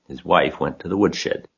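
ordinary delivery

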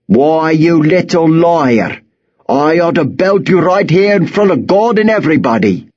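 high, angry farmer voice; threatening farmer. Acted. Knit Cap turns on the lying boy, threatening. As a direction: threaten a beating, pitched well up.

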